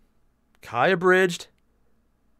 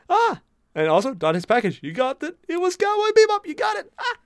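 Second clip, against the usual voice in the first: high, loud delighted call-out; excited; 5 dB louder than usual. Real, not acted. Streamer thrilled a viewer got the Cowboy Bebop reference, high excited. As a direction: cheer that someone got it.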